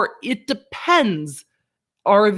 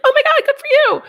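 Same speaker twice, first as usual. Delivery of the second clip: much higher, louder sing-song praise; praising a kid; 5 dB louder, faster than usual. Pretend. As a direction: cheer on a little child. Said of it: "to a kid where you're like".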